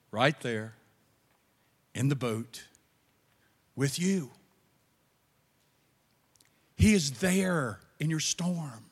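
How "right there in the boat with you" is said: much softer, lower answer; quiet answer. Real, not acted. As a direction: drop to a soft certainty. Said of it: "repeated answer, softer".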